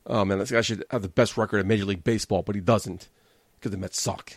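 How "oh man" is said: normal talking voice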